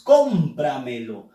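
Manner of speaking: "Cómpramelo" con la primera sílaba marcada, voz más grave al caer; acento en "com". Shown as a demonstration. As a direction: marca "Cóm" y deja caer el resto de "Cómpramelo". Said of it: "com lleva la fuerza de voz". ("Cómpramelo" cargando la voz al inicio)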